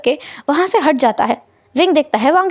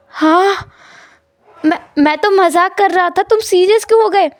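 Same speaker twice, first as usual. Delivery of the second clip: 1 nervous backpedal; 6 semitones higher than usual. A narrator's reading.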